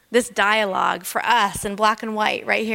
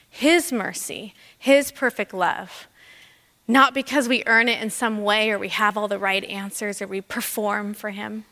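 usual speaking voice

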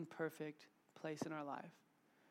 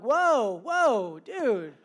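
loud, high whoas imitating an alarmed dad; alarmed halting shout; 6 semitones higher, 17 dB louder, much slower than usual. Dad stopping his sauce-covered son, loud alarm. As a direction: stop a messy kid in his tracks.

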